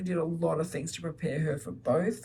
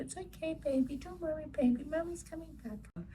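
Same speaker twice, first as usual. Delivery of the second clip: put-on high soft little-girl voice, much higher and softer; imitating toddler comforting; 11 semitones higher, 6 dB quieter than usual. Toddler comforting her crying baby brother, high soft coo. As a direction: coo it gently like a small sister soothing a baby. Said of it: "Anytime he's upset".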